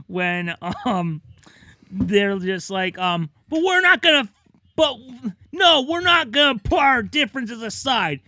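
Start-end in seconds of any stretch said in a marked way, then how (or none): 3.5-8.2 put-on stubborn kid. Stubborn kids voiced in bit.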